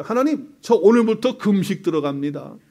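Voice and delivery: high, loud mock ultimatum to God; hammed-up threat to fast until death; mock threatening prayer. Believer threatening God with a fast, loud mock ultimatum. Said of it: "협박을 이렇게 하는 거예요".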